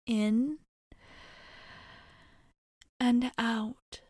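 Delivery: measured cues with long pauses; unhurried, spaced breathing count; guided breathing. Acted. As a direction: coach someone through calming breaths. Leads breathing exercise to calm someone.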